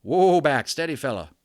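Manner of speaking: loud high whoa easing into steady; calming the horse. Acted. Cowboy soothes his frightened horse, loud then settling. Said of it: "said something to the horse".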